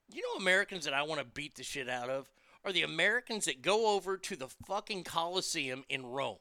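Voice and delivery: ordinary delivery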